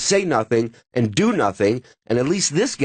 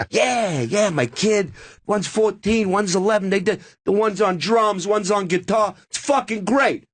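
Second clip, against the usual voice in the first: high, emphatic mock bragging about his kids; boast, then issue an order; imitating Dice; 6 semitones higher than usual. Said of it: "He goes".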